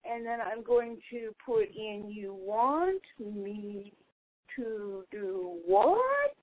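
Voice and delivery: soft, very slow, talking while typing; distant, distracted typing voice; away from the phone. Real, not acted. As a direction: draw out each word as you type it. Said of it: "I'm setting down the phone".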